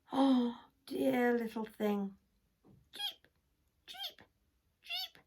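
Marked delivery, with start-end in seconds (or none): 2.9-5.2 very high tiny cheeps; chick cheep. Acted. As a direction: peep like a newborn chick.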